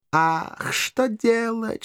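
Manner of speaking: high, slow mock dismay; drawn-out hammy musing; theatrical pondering. A narrator's reading. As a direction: wonder aloud with stagey woe. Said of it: "театрально задумался Эшли".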